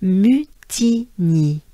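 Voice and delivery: Mutigny drawn out as mü-ti-nyee; slow French pronunciation. Shown as a demonstration. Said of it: "we go back up a little bit and we have".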